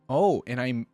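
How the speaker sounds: ordinary delivery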